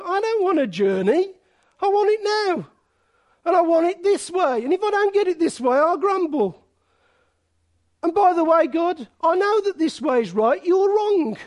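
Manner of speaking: mock whine of a believer wanting it now; whiny demand. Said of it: "This is me and you".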